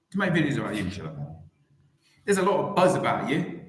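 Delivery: ordinary talk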